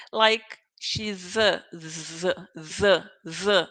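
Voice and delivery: "she's a" drawn out slowly with a buzzing Z; linking Z sound. Shown as a demonstration. Teacher showing students the linked Z sound, slow. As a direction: stretch the Z in "she's a" and buzz "Z, Z". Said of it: "the sound will be like a Z".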